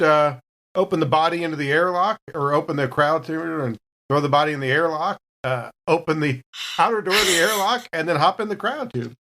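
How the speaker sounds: ordinary talk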